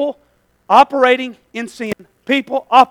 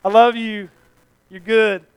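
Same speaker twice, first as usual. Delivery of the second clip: mock congregation; 2 semitones higher, 6 dB louder, slower than usual. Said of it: "All y'all are like".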